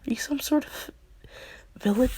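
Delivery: high, startled question to a stranger; scared question. Retold or imitated. Girl startled by the boar-headed man, nervously asking. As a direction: ask it wide-eyed and spooked. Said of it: "she's like, scared".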